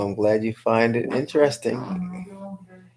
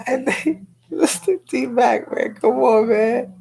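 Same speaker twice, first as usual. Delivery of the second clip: excited teasing; 12 semitones higher, 4 dB louder, slower than usual. Real, not acted. Host teasing T-Mac excitedly.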